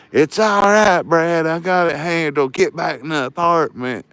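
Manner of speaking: loud, high retold yell; yelling landlord. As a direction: imitate a worked-up landlord ordering someone back. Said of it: "My landlord's yelling and going crazy".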